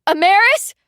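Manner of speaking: high, loud call through a door. A narrator's reading. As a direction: call her name, worried. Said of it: "I called".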